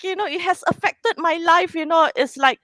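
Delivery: ordinary talk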